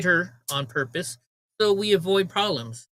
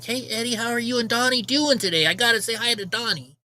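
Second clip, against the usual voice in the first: higher-pitched imitation of a friendly greeter; friendly greeting; 6 semitones higher than usual. Another store lady greets Eddie and his dog, imitated friendly high voice. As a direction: play the employee happy to see a regular.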